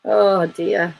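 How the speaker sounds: ordinary talk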